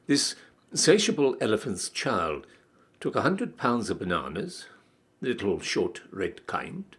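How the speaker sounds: ordinary delivery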